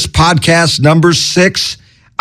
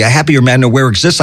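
ordinary talk

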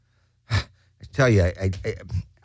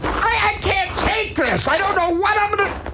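panicked; 12 semitones higher than usual. Acted. Paranoid panic outburst.

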